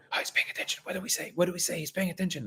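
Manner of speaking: hushed voices whispering all around; soft, secretive whispered chatter; whispers. Retold or imitated. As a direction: whisper it like the room is gossiping. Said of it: "I hear, like, whispers all over the room".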